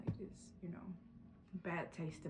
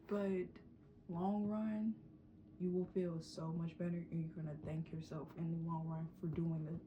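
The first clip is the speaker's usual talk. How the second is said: normal talking voice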